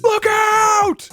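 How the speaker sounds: high, loud panicked yell; hammed-up yell for a crash sound effect; mock warning shout. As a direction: shout it like someone about to crash. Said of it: "What would I be yelling?".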